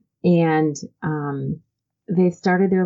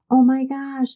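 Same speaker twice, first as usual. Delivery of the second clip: put-on higher, thrilled child's voice; excited little boy; 10 semitones higher than usual. Boy shows his mom the pillowcase, higher and excited. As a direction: squeal it like a delighted kid. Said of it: "And the boy said".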